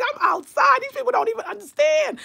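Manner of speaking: high, laughing, gleeful crowing; laughing boast. Retold or imitated. Recalling feeling great in public, high laughing boast. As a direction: crow it out through laughter, way up high. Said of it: "I was like".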